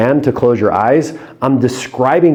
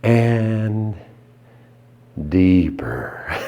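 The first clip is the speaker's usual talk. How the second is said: hypnotic deepener; 5 semitones lower, 5 dB quieter, much slower than usual. Shown as a demonstration. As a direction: lower and softer, slow trailing voice. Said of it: "Next is".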